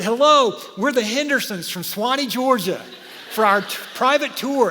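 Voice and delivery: cheery loud greeting. Retold or imitated. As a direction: call out brightly to the guard. Greeting Pixar guard from car.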